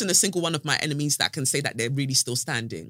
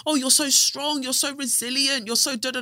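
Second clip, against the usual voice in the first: higher, sing-song gushing voice; mimicking gushing praise; 8 semitones higher, 3 dB louder than usual. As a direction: parrot people's gushing. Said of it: "when people say".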